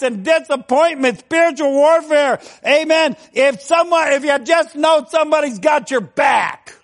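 high-pitched, driving preacher delivery; preaching. Real, not acted. Preacher rallying fellow soldiers against the devil, preaching.

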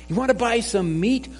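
lightly voiced jokey butcher; joking butcher. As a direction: tease the dog like a jolly shopkeeper. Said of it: "the butcher jokingly asks".

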